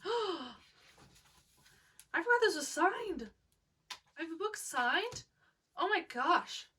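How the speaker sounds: real surprise at the signed copy; surprised gasp. Real, not acted.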